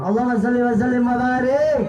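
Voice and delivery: ordinary delivery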